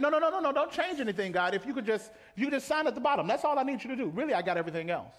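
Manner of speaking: hurrying God to just sign off; pushy believer. Retold or imitated. Preacher voicing a believer brushing off God, pushy.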